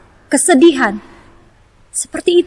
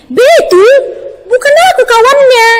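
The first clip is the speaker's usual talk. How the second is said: much higher, loud Batak accent; 11 semitones higher, 4 dB louder than usual. Shown as a demonstration. Teacher imitating a Batak friend's dialect for children, Batak accent. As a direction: sing it out in a strong Batak accent. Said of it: "teman kita yang, eh, orang Batak".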